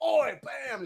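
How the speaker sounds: high mock Cockney yell; Cockney punch-up shout. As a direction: yell it like a brawling Londoner. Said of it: "he'd punch you in the face".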